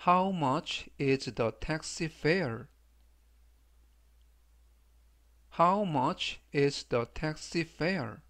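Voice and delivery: very slow, every word separate, "How" stressed; slow, careful model of the taxi question; slow read three times. Shown as a demonstration. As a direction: read it slowly for students to repeat. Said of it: "제가 역시 천천히 세 번씩 읽어드리고요".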